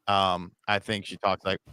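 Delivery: usual speaking voice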